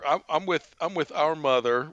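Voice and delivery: ordinary talk, nothing marked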